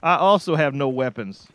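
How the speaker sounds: high, loud, alarmed questions. Real, not acted. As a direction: panic at being unarmed. Alarmed at having no weapons.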